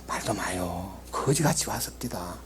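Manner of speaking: hushed whisper. Acted. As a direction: lean in and whisper it like a secret. Servant whispers news of brother.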